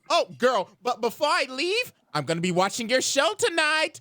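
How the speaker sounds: put-on high, sugary girl-talk; put-on friendly lilt with menace behind it; mock-sweet parting line; fake sweetness. Azula's veiled threat, sweetly put.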